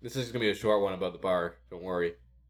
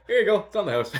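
cheery bartender; 10 semitones higher, 6 dB louder, faster than usual. Retold or imitated. Bartender handing over the shot.

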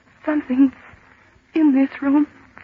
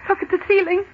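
usual speaking voice

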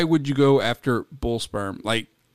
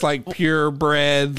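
slow disbelief; much slower than usual. Real, not acted. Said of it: "slow incredulous".

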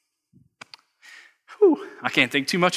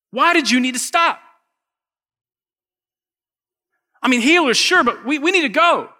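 high, loud, pleading frustration; a worried father pushing Jesus to hurry up, loud and high; exasperated Jairus; 9 semitones higher, 6 dB louder than usual. Retold or imitated. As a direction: plead with the healer like a desperate dad who's out of time. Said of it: "but I tell you what I'm thinking".

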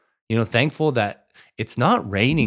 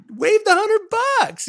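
very high, drawn-out disbelief; amazed exclamation; 4 dB louder, much slower than usual. Real, not acted. Marveling at the waived toll penalty, amazed. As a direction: squeal it slowly in amazement.

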